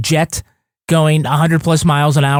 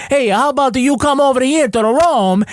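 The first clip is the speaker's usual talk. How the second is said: higher-pitched, put-on Italian accent; 7 semitones higher than usual. Podcaster voicing the mafia inviting a guy to Rome, mock Italian accent. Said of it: "I'm gonna do an Italian accent".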